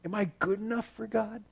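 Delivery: very soft inner question; small, quiet doubting voice; hushed self-doubt. Acted. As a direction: ask it almost under your breath. Voicing an anxious inner question.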